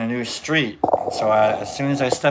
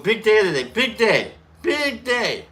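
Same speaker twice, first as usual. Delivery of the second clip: building excitement; 9 semitones higher, 5 dB louder than usual. Real, not acted. Building excitement, pitch rising.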